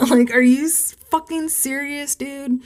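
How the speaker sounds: high-pitched, exasperated disbelief; retold "you can't be serious" outburst, voice high; incredulous outrage. Retold disbelief, after a laugh.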